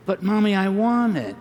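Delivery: put-on high, whiny little boy voice; fussing child. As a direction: plead like a fussy toddler. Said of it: "this child was also fussing".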